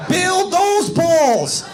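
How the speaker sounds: high, drawn-out holler; mock shout. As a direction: belt it out slowly as a punchline. Acted shouted punchline to the crowd.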